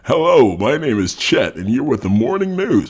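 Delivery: high, loud, put-on TV presenter voice; booming, high-pitched newsreader parody; morning news anchor. Shown as a demonstration. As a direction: announce it like a morning news host, big and high. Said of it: "sound like a different person".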